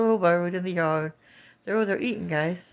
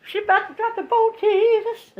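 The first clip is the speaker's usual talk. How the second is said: squealing Jesus in high alarm; panicking as someone nearly drops the boat; high excited cries; 5 dB louder than usual. Real, not acted. Mom watching a clumsy daughter, alarmed high cries.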